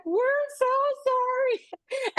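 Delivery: high, crying apology; tearful apology. Retold or imitated. Girls apologizing back to her, tearful. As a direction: sob out an apology.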